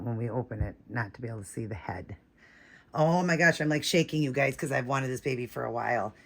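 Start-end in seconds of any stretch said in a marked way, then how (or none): 0.0-2.2 whisper. Real, not acted. Leaning in close to film.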